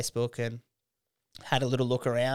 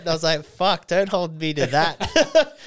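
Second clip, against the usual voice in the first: laughing through the words, slow and high; retold reply broken up by laughter; 7 semitones higher, 8 dB louder, slower than usual. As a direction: giggle out the reply, louder. Retelling own reply, laughing.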